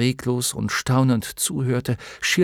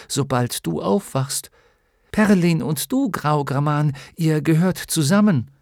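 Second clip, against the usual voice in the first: put-on higher, louder, eager boy; excited; 6 semitones higher, 3 dB louder than usual. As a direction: tell it with rising excitement. Bastian explains with enthusiasm.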